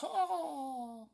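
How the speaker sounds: long high falling wheeze; imitated seagull cough. Shown as a demonstration. Quitter showing how the cough sounded, seagull-like. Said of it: "That's how I sounded".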